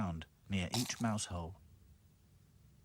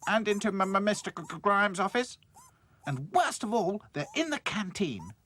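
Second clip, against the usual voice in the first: high, loud, stammering in alarm; 11 semitones higher, 7 dB louder than usual. Acted. Dotty reporting mice everywhere, stammering. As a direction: stumble over the names, alarmed. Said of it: "said Dotty".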